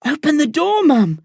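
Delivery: high, strained cry; desperate plea. A narrator's reading. Boy slipping from the cell door, begging mum desperately. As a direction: beg with rising urgency.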